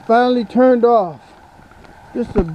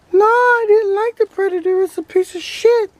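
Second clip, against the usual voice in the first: higher, cheeky toy duck voice; duck puppet voice; 10 semitones higher than usual. Acted. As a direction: voice the toy duck answering back. Ducky complains about the Predator.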